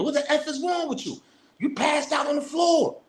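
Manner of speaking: high shouted alarm, slowing at the end; imitated yell. Friend yelling at the brother passed out on the floor, high alarmed shout. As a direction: yell at him to snap him out of it.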